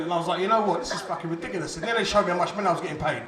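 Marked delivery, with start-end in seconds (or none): none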